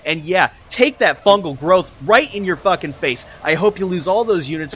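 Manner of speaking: loud, spiteful shout; raging taunt. Real, not acted. Caster cursing a player he is angry with, loud spiteful taunt. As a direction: hurl it like a curse at the screen. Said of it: "I am super raging right now".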